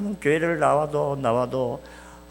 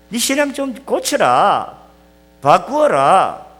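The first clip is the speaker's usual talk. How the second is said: slow exhortation; 6 semitones higher, 9 dB louder, slower than usual. Acted.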